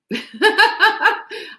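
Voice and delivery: a short, high burst of real laughter. Real, not acted. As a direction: laugh out loud at the absurdity. Laughs at client quitting.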